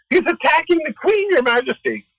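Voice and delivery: high-pitched hammed-up alarm; mock alarm. Retold soldiers alerting the king about Haman, high mock alarm. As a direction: raise the alarm in a high, staged cry.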